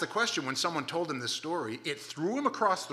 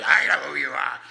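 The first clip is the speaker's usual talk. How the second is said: loud, menacing demon growl; 12 dB louder than usual. Retold or imitated. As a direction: threaten like a possessed man. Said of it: "It's that kinda guy".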